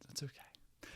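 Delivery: breathy unvoiced whisper of an aside. Real, not acted. Man whispering aside to audience about a girl who forgot him, unvoiced whisper. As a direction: whisper it under your breath.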